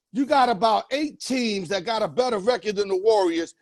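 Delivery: ordinary talk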